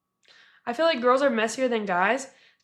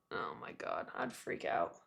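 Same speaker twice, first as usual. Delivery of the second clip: whispering; 15 dB quieter than usual. Real, not acted. Dreading a guy near makeup.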